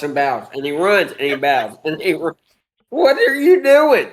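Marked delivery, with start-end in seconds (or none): 2.9-4.1 baffled mock question. Acting out puzzled Esau watching.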